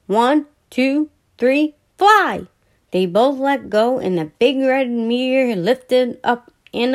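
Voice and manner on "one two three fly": higher, louder countdown call; launch countdown. A narrator's reading. Brother counting Billy down to let go, excited.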